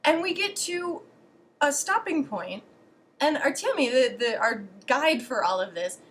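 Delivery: ordinary talk, nothing marked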